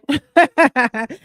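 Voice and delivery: a loud, high burst of laughter; short laugh. Real, not acted. Closing her live with a loud laugh.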